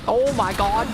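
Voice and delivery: loud burst of alarm; startled shout. Real, not acted. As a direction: yell it as things go wrong. Game chaos, shouting.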